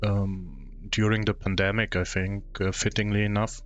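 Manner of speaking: normal talking voice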